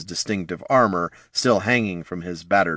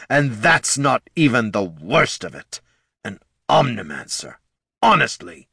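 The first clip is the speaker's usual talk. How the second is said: fuming; 3 semitones higher than usual. Acted. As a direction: rant with mounting fury. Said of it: "the Lich fumed".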